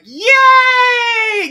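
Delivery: loud, high, drawn-out whoop; exuberant cheer. Shown as a demonstration. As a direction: shout it out in over-the-top glee. Demonstrating what fun sounds like.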